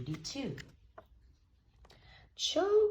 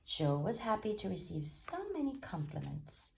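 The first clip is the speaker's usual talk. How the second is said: usual speaking voice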